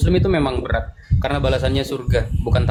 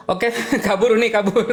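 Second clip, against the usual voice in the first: real laugh breaking into the words, pitched up; 8 semitones higher than usual. Real, not acted. Host laughing at the guest who fled the call. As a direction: chuckle it out as the guest bails.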